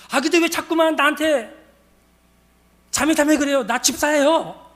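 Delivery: loud, high acted complaint; imitated huffy drunken protest; indignant protest. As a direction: object as if insulted. Drunk woman objects to her title.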